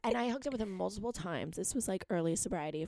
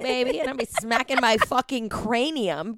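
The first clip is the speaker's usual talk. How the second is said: loud rant; 3 semitones higher, 12 dB louder, slower than usual. Retold or imitated. Ranting about cracking her skull.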